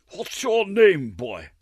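high, loud and slow, a puffed-up official; hammed-up fat gentleman questioning a child; pompous chairman. A narrator's reading. Red-faced chairman addressing the frightened boy, pompous and slow.

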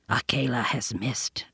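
low unvoiced panther whisper. A narrator's reading. Panther whispering warning to Mowgli, hushed. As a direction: breathe it softly as Bagheera. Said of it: "said the panther".

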